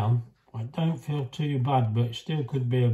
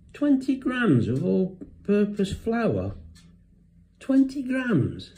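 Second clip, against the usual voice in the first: slow, much higher, drawn-out disbelief; incredulous; 8 semitones higher, 4 dB louder, slower than usual. Real, not acted. Reading the recipe to viewers, slow and incredulous. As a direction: read it out like it can't be right.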